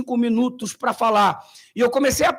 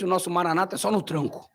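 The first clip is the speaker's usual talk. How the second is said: ordinary talk, nothing marked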